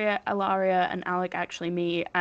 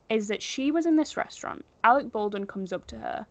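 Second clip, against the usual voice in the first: usual speaking voice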